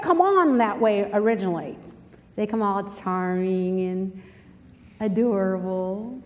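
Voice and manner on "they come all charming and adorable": slow, low, lightly smooth mock charm. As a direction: draw it out like a would-be charmer. Mimics how bozos charm girls.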